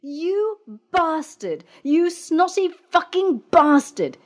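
high, slow furious insult; angry yell. A narrator's reading. Worker yelling at her boss, furious. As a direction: spit it at him like an enraged woman. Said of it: "she yelled".

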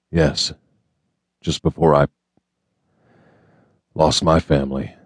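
halting, with long pauses between words; reluctant to say it, pausing before the last words; hesitant. A narrator's reading. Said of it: "he said hesitantly".

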